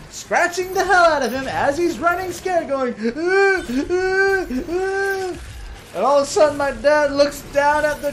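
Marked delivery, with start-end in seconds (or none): 3.0-5.4 put-on high, repeated yelps of a fleeing kid; kid screaming. Kid chased by the cat, high panicked yelps.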